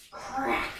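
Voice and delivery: breathy whispered crack; crack sound effect. Acted. Teacher voicing the ice cracking for the kids, whispered crack.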